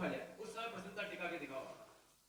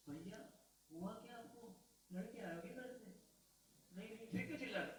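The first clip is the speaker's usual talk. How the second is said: calm amused reply; 2 semitones higher, 6 dB quieter than usual. Pretend. Calm prankee answering shouting man.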